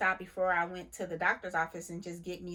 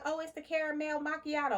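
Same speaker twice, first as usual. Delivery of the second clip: higher mock cashier voice; mimicking the cashier; 7 semitones higher than usual. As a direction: toss it off like the bored cashier. Voicing the cashier girl.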